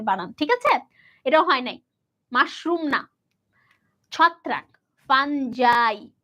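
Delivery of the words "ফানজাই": ফানজাই said slowly and carefully, each syllable clear; correct pronunciation. Shown as a demonstration. Teacher showing students how to say ফানজাই, slow and careful. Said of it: "এটার উচ্চারণ কি?".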